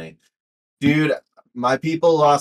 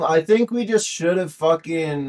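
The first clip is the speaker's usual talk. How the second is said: higher-pitched Irish accent attempt; put-on Irish voice, raised pitch; 6 semitones higher than usual. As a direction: have another go at an Irish accent. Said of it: "do your best Irish accent".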